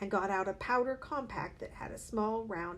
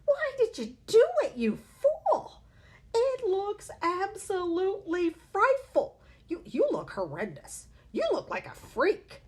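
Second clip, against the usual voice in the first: shrill mockery; 12 semitones higher, 9 dB louder than usual. A narrator's reading. Mother berating husband, shrill voice.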